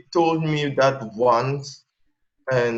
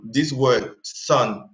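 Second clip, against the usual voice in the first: usual speaking voice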